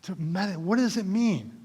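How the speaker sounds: usual speaking voice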